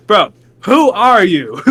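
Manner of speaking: fed up, demand an answer; exasperated. Retold or imitated. Asking the mailman who he is again, exasperated. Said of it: "I was like".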